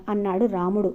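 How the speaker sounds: plain reading voice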